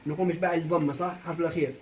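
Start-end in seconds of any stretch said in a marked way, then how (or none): none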